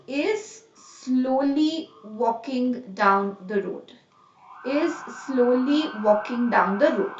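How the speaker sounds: drawn-out, slowed example sentence; slow model sentence. Shown as a demonstration. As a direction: spell out is slowly walking for students. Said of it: "the adverb will come in between".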